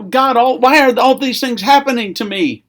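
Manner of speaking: high, loud lament; anguished cry to God. Acted. Someone in pain and loss asking God why, anguished cry. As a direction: cry out in pained disbelief. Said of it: "Maybe we're even screaming at God".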